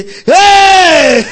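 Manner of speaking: long, high, loud holler; sustained whooping "hey"; demonstrated shout. Shown as a demonstration. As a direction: shout it out and hold it. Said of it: "I tell you what I practice".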